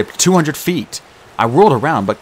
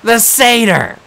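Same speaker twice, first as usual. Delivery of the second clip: high, loud yell; blurting it out; 12 semitones higher, 5 dB louder, slower than usual. A narrator's reading. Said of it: "Clarice yelled".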